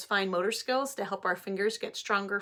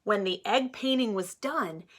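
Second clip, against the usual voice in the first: put-on reading to children; 4 dB louder than usual. Teacher reading Pete the Cat aloud to her class, animated. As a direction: read it like story time for little ones.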